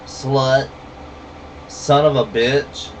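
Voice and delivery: usual speaking voice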